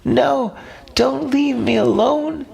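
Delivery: high, desperate cry not to be left alone; frightened cry. A narrator's reading. Little fish crying out to the octopus, high and desperate. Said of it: "Majib cries out".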